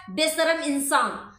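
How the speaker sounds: ordinary talk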